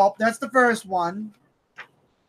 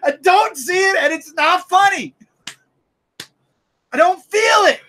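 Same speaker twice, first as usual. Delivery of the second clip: exasperated shout; 7 semitones higher than usual. Real, not acted. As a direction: belt it in full frustration. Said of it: "making my jaws hurt from all that".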